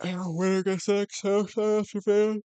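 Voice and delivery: a hint of a slow, high ring announcer; lightly voiced announcer hype; mock announcer. Announcer-style callout of matchup.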